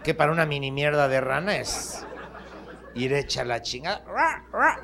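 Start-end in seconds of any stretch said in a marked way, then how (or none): 4.1-4.8 frog croak. Acted. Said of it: "le decían".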